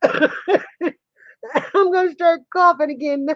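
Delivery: high voice fading as laughter takes over; slow, laughed-out words running out of breath; helpless laughter. Real, not acted. Laughing so hard she may start coughing, helpless laughter.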